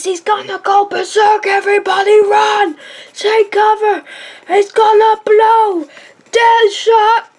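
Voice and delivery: mock panic shout; panicked shouting. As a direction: warn everyone to run for cover. Acted panic, telling everyone run.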